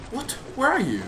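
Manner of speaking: ordinary delivery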